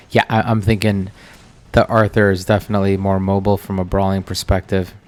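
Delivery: ordinary talk, nothing marked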